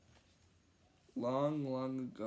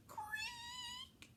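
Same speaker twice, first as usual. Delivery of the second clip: high squeaky creak of a door; creaky door sound; 11 dB quieter than usual. A narrator's reading. Narrator creaks the door open.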